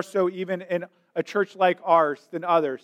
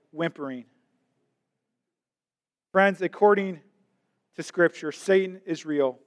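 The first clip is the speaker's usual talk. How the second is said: ordinary talk, nothing marked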